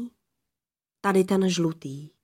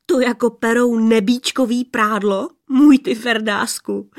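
teasing disbelief; 3 semitones higher, 6 dB louder than usual. Acted. Adam teasing old woman.

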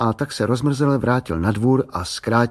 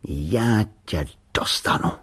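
slow, whispered threat; dangerous voice; slower than usual. A narrator's reading. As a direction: whisper it menacingly, word by word. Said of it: "řekl nebezpečným hlasem".

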